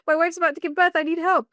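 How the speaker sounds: husband begging the ambulance line for help; urgent plea. Retold or imitated. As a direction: plead for help, rising in pitch. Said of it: "laughs And Adam was like".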